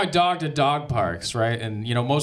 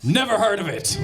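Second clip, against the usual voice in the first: put-on high dismissive Russian accent; 9 semitones higher than usual. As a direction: scoff in a thick Russian accent. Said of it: "Soviet type accent".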